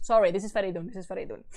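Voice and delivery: ordinary delivery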